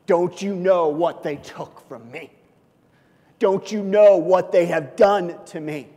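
slow, indignant complaint; mock grievance. Voicing an unforgiving believer, mock indignant. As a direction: play the wounded grudge-holder. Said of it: "basically says".

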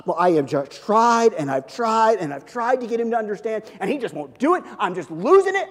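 a God at his wit's end over Aaron; exasperated God. Retold or imitated. Preacher acting God fed up with Aaron, loud exasperated. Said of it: "sitting up in heaven going".

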